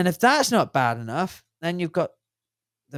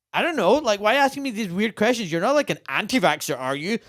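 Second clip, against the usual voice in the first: higher, louder, fast flustered doctor; defensive doctor; 6 semitones higher, 4 dB louder than usual. Retold or imitated. Imitating doctors dodging questions, mock defensive. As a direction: brush off the question, quick and defensive.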